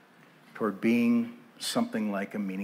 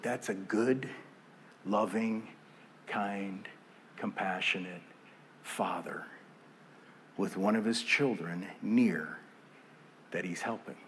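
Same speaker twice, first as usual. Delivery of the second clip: tender; 4 semitones lower, 7 dB quieter than usual. Real, not acted. Describing the loving father.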